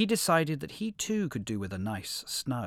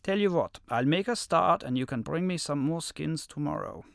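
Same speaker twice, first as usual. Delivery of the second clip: wolf voice; 8 semitones higher, 5 dB louder than usual. A narrator's reading. Wolf tricks the lion.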